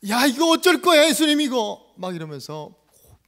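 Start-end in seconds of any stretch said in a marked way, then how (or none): 0.1-1.9 loud, high acted tirade; angry scolding. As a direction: bellow it like an outraged disciple. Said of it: "호통을 치면서". (호통치는 목소리)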